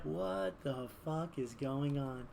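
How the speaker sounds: slow, hushed disbelief; soft, drawn-out bafflement; acted bewilderment. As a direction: play the stunned onlooker. Everybody baffled by the cooing.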